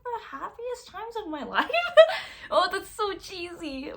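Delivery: high-pitched laugh through the words; real, embarrassed giggle rising high. Real, not acted. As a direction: crack up at your own cheesiness. Laughs at own cheesy remark.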